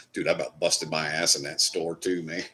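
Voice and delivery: ordinary talk, nothing marked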